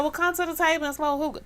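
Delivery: high, quick imitated pitch; mock eager suitor. Retelling the guy's hookah invitation, high and hurried. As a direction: rattle off the invitation, mimicking him.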